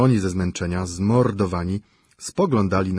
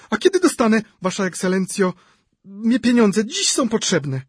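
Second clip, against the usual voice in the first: shrill complaining widow; 14 semitones higher, 5 dB louder than usual. A narrator's reading. Szczukina voiced by reader.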